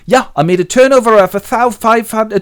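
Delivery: high, loud bragging; acted boast. Willie tells Linda his turnover, boastful. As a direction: brag loudly, then start to backtrack.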